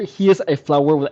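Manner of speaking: ordinary talk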